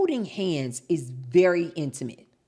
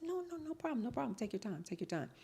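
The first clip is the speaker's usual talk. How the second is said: sweet high hostess; 7 semitones higher, 12 dB quieter, faster than usual. Retold or imitated. Retold reply to contractor.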